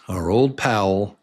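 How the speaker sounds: ordinary delivery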